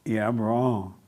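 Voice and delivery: a touch of quiet defeat; quiet self-blame. Retold or imitated. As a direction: mutter it like you've given in. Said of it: "you agree with it".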